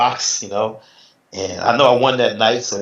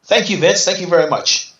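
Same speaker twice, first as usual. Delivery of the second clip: hammed-up fake thanks from the stage; mock polite dismissal; 7 semitones higher than usual. Wayne's brush-off retold.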